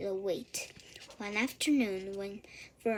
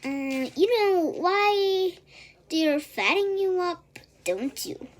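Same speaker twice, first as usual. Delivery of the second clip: higher, louder, slow knowing sheep voice; sly old sheep; 9 semitones higher, 9 dB louder than usual. A narrator's reading. Old sheep hinting to Wilbur, slow and knowing. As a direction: drag it out like a gossiping old ewe.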